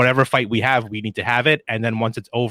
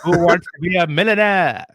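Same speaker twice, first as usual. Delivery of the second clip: game show host; 8 semitones higher, 5 dB louder than usual. Pretend.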